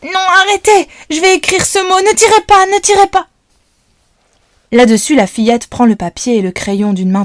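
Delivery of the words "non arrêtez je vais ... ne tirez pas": panicked high scream, louder; girl hugging her dog, screaming at the gunman. A narrator's reading. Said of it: "se met à hurler".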